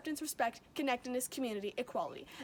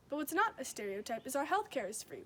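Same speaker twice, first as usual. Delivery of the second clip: normal talking voice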